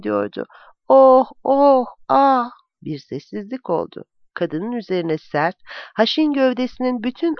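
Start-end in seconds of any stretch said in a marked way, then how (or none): 0.9-2.5 louder, higher drawn-out moans; woman moaning at the climax; woman's moans. A narrator's reading. Lovers heard through the wall.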